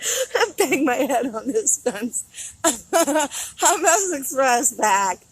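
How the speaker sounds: real laughter through slow words; laughing. Real, not acted. Banged head on the fence, laughing. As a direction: giggle through it, slowly.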